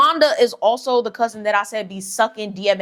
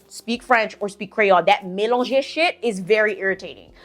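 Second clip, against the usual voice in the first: ordinary talk, nothing marked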